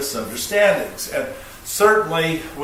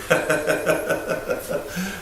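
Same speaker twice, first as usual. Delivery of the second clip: amused chuckle after own demo; laughing; 4 semitones higher than usual. Real, not acted. Speaker amused by his demonstration, chuckling. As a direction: laugh at your own example.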